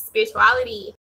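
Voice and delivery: ordinary talk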